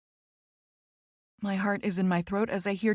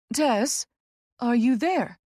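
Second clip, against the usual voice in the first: higher, searching question; note of concern; 4 semitones higher, 4 dB louder, slower than usual. A narrator's reading. Friend checking Tess is on the line, concerned. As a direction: check on her with mild worry. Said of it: "clear note of concern in her tone".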